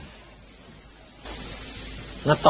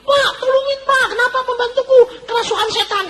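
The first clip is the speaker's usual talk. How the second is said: pleading couple; 7 dB louder than usual. Retold or imitated. Couple begging pastor at night.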